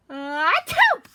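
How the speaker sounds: sharp loud achoo; loud sneeze. Acted. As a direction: sneeze loudly.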